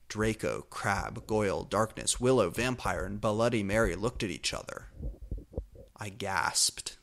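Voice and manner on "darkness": plain reading voice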